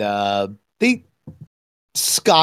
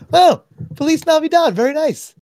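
high, louder voice quoting his son; imitating son; 14 semitones higher, 4 dB louder than usual. Father retelling his son's kitchen reaction, imitating him. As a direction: pitch it way up as the approving son. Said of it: "and he's like".

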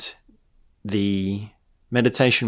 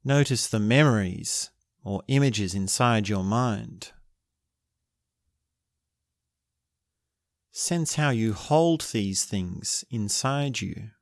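guided meditation; 5 dB quieter than usual. Acted. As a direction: guide them gently into stillness.

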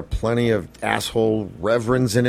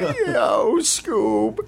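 Shaggy voice; 10 semitones higher than usual. Acted. Imitating Shaggy back.

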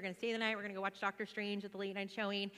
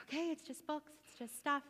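crying; 6 semitones higher than usual. Retold or imitated. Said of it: "I'm crying".

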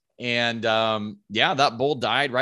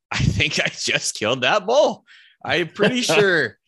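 high, louder, thrilled disbelief; can't believe it, giddy; retold giddy realization after the shot; excited disbelief; 5 semitones higher, 5 dB louder than usual. Realizing he killed the bull.

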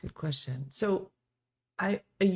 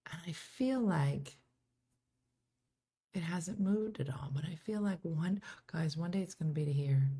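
whispered confession to the viewers; 8 dB quieter than usual. Real, not acted. Host whispering to her livestream viewers about her gray, a secret whisper.

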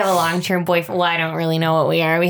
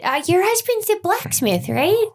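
higher, keen small-talk voice; eager bright questions; 9 semitones higher than usual. Acted. Adventurer asking the elf writer about her husband, eager and high. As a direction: lift up high and chatty.

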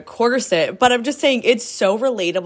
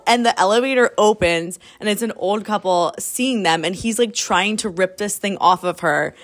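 usual speaking voice

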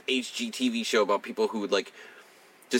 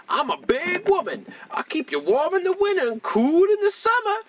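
imitating loud patient; 3 dB louder than usual. Retelling a patient across the hall, high mock woman. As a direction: ham up a brassy woman. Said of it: "she's just like".

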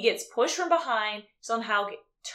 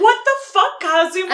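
high, loud exasperated outburst; indignant squawk of mock outrage; 9 semitones higher, 10 dB louder than usual. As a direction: yell it in hammed-up disbelief.

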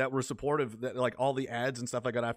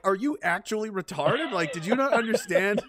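incredulous; 6 semitones higher, 6 dB louder than usual. Retold or imitated. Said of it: "I'm like".

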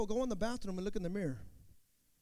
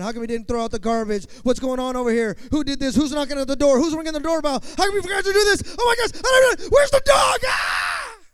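frantic home yelling; 12 dB louder than usual. Retold or imitated. Parent at home ranting about the mess, building to shouts. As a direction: rant louder and louder until you're screaming. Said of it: "you go home and like".

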